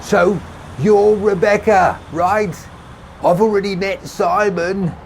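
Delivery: high, loud call across traffic; shouting over noise. A narrator's reading. Tommy introducing himself over passing cars, shouting. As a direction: shout to be heard. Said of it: "he repeated with increasing volume".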